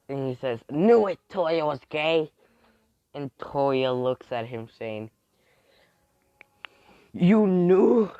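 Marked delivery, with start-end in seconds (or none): 0.7-2.3 loud, high, drawn-out crowing; gleeful exclamation. Acted. Character catching Toya and Hawks kissing, loud crowing exclamation. 7.1-8.1 very loud, high-pitched disbelief; shocked outburst. Acted. Toya to Hawks on being found out, loud shocked question.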